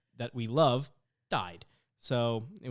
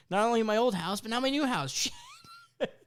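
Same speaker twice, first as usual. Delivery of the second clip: ordinary talk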